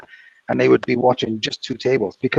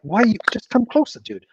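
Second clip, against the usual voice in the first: higher-pitched retold plea to a far-off boss; mock calling out; 8 semitones higher than usual. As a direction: imitate calling over to someone. Retold shouted exchange with distant boss.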